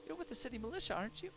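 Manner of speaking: very high pleading voice of a woman in need; distressed townswoman. Acted. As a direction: plead in a high, put-on female voice.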